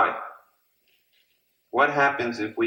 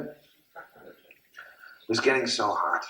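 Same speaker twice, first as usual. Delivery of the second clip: weary drawl; 4 dB quieter, much slower than usual. Acted. Describing the heat in the kitchen.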